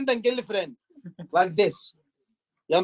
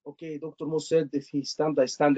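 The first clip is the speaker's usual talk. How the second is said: flat, lower, mechanical recital; robotic student; 8 semitones lower, 5 dB quieter than usual. Shown as a demonstration. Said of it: "I don't want to be robotic".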